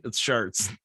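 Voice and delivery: "Sharts" said with an ar sound instead of or; imitating the father-in-law: "Sharts", open ar vowel; Sharts for Shorts. Teasing the wife's dad's accent, "Sharts" with ar. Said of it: "Shorts Brewery".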